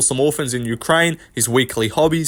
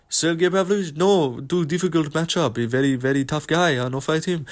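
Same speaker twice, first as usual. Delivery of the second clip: quieter imitation of a fighter in a mock French accent; lightly done mock French accent, a bit softer; 3 dB quieter than usual. Imitating a fighter on a radio show in a mock French accent. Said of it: "He goes in the M M A Hour and is like".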